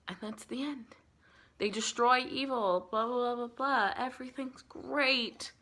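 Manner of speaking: slow, high sing-song brush-off; mock cheery. Real, not acted. Summing up the happy ending, mockingly sweet. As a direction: wave it away in a sugary voice.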